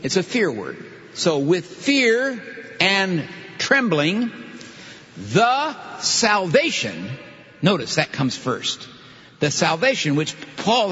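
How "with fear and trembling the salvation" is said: very slow, high, stretched recitation; drawn-out, deliberate quotation; literal rendering. Shown as a demonstration. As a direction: lay out each phrase with weighty pauses. Reciting literal Greek word order.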